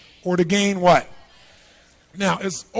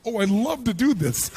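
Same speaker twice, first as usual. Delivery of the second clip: mock delight; 4 semitones higher than usual. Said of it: "How many wake up in the morning".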